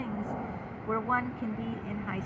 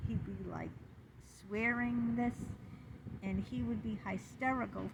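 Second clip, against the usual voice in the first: usual speaking voice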